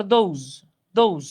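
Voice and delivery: দোজ as "dhouz", gliding o and final z; pronunciation of those. Shown as a demonstration. Teacher repeating the correct sound for students, careful pronunciation demo. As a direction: say each দোজ separately with an "ou" vowel. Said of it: "এটার উচ্চারণ হলো".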